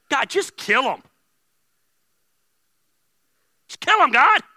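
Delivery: high, loud mock plea; shouted angry prayer. Preacher acting out his own angry prayer, loud shout.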